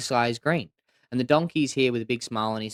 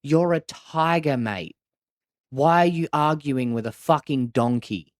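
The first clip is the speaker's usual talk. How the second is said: slow, emphatic rebuke with raised voice; lion king's slow, incredulous scolding; exasperated lion; 5 semitones higher, 5 dB louder, slower than usual. Acted. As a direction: drive the point home slowly, louder and higher on the key words. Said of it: "and the lion goes".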